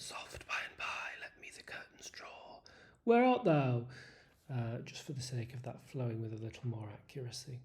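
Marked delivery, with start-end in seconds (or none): 0.0-3.9 soft unvoiced whisper; going quiet. Shown as a demonstration. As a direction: hush it to a whisper. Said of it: "and then going quiet".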